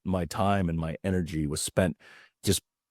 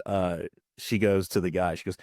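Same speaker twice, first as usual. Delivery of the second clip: ordinary talk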